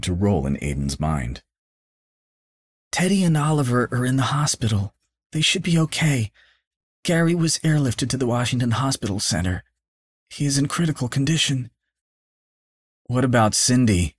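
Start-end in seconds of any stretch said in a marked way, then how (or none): 2.9-11.7 lightly voiced mother, higher; mother telling her son who survived. A narrator's reading. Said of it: "Aiden asked his mother". 13.1-14.2 anxious question. A narrator's reading. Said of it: "Aiden asked his mother".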